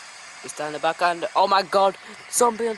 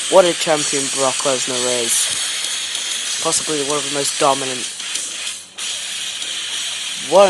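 loud, slow, deep ring-announcer boom; booming title-retention announcement; big announcer call; 8 semitones lower, 6 dB louder, slower than usual. Real, not acted. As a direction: proclaim the winner to the arena. Match ends, champion retains title.

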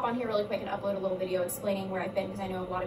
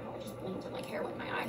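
whispering; 2 semitones lower, 9 dB quieter, much faster than usual. Real, not acted. Hair in her eye.

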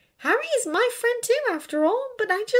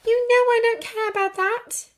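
high, indignant retort; hissed; 6 dB louder than usual. A narrator's reading. Hermione rebuking Draco's teasing, testy. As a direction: protest it in a raised, testy voice. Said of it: "she hissed".

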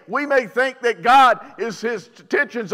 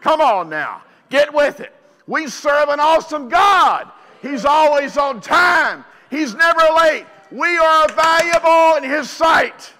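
full-force preaching; 7 semitones higher, 7 dB louder than usual. Acted. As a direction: preach it at the congregation full throttle.

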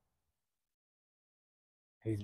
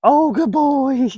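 higher, louder, drawn-out praise; excited dog praise; 10 semitones higher, 7 dB louder, much slower than usual. Real, not acted. Trainer praising dog, high loud drawn-out gushing. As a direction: gush over the dog.

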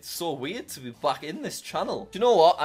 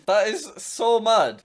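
slow, loud, higher amazement; amazed; 6 semitones higher, 5 dB louder, slower than usual. Real, not acted. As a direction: really savor the disbelief. Amazed at old watch history.

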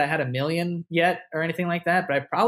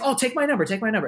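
higher, eager insisting voice; imitating Jenna; 8 semitones higher, faster than usual. Jenna offering her number in New York, high and eager. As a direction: lightly mimic her pushing the number. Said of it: "she's like".

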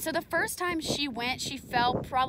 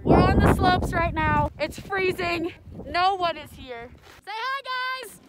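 excited greeting; 8 semitones higher, 7 dB louder than usual. Real, not acted. Greeting viewers on a freezing slope.

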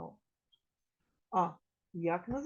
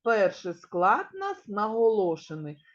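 ordinary delivery

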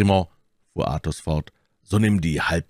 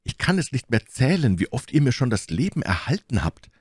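higher-pitched, grateful character voice; eager young musketeer; 8 semitones higher than usual. A narrator's reading. D'Artagnan thanks the friend who saved him, grateful. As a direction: lift the pitch, earnest and grateful.

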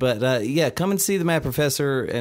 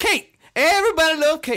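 Shrek voice; 12 semitones higher, 6 dB louder than usual. Retold or imitated. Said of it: "Shrek bit continued".

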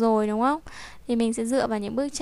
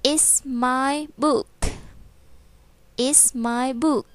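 slow read-aloud; 2 semitones higher, much slower than usual. Shown as a demonstration. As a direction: read it out slowly for the class. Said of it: "đọc to nào".

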